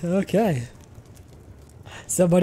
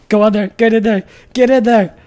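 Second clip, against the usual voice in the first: higher, louder, egging him in; urging shout; 6 semitones higher, 10 dB louder than usual. Real, not acted. Streamer urging a raider into his trap, shouting. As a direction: yell at him to walk in.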